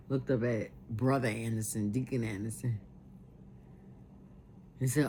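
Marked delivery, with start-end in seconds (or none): none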